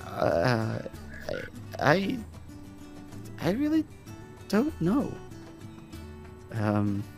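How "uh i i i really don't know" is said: hesitant, soft stammer with long gaps; halting, evasive non-answer; acting strange. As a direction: stall awkwardly, feeling for an answer. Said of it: "You're acting real strange".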